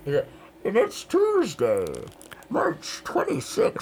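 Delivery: Bane voice. Acted. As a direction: do your best Bane. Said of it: "Bane is like".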